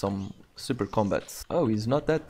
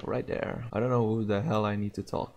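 normal talking voice

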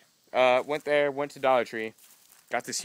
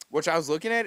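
normal talking voice

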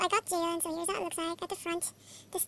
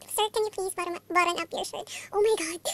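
normal talking voice

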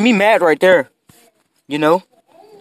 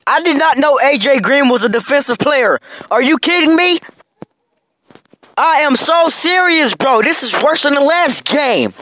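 higher, fed-up complaining; exasperated disbelief; 8 semitones higher than usual. Real, not acted. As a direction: rant at the game in disbelief. Losing, ranting at game.

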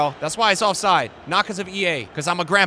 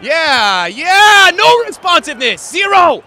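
high, loud exasperated yell; angry shouting; 7 semitones higher, 9 dB louder, slower than usual. Real, not acted. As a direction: shout each complaint at full voice. Gamer shouts at unresponsive game.

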